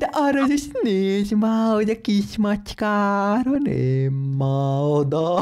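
high, slow cooing. Real, not acted. Player cooing at the little kitten, slow high. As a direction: coo over the tiny kitten.

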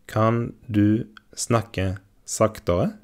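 slowed right down, word by word; careful slow model of Kan du snakke saktere; slow teaching repeat. Shown as a demonstration. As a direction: say it slowly for learners to copy. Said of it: "slow repeat for learners".

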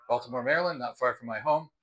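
normal talking voice

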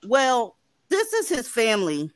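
ordinary talk

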